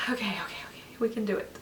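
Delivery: whispered pep talk to herself; calm yourself in a whisper. Real, not acted. Psyching herself up to submit.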